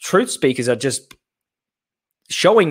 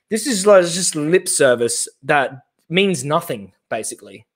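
ordinary talk, nothing marked

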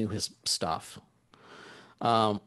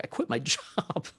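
laughing through the dismay; soft, half-laughed realization; retold dismay; 3 semitones higher, 5 dB quieter than usual. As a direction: retell it quietly with a rueful laugh. Retelling his own dawning panic.

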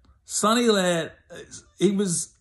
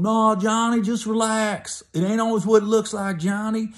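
higher, soothing plea to calm Johnny down; pleading neighbor; 8 semitones higher, 3 dB louder than usual. Retold or imitated. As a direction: coax him to calm down. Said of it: "You got some people in trouble going".

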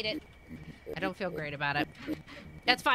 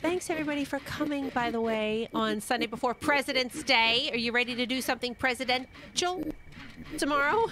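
high, bright chat greetings; streamer shout-outs; 8 semitones higher than usual. Acted. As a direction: greet the viewers one by one.

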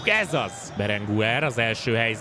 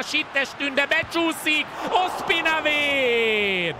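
excited save call; 8 semitones higher, much slower than usual. Acted. Goalkeeper save called live.

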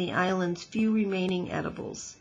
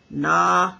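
croaking frog; 9 dB louder than usual. Acted. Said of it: "croaked the first frog".

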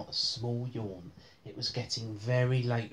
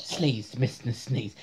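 ordinary delivery, nothing marked

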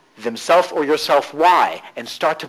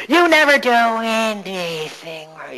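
slow, higher nagging mother voice; 7 semitones higher, slower than usual. Shown as a demonstration. Coach voicing the nagging mother gremlin, slow and higher. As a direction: drag out the nag like a mother. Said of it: "your nagging mother".

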